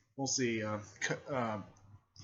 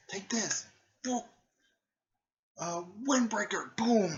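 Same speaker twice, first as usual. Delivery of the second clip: high-pitched battle call; acted attack call; 9 semitones higher, 4 dB louder than usual. Character calls out attack moves.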